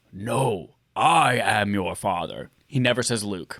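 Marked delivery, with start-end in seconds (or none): 0.1-2.5 Darth Vader voice. Retold or imitated. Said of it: "He actually says".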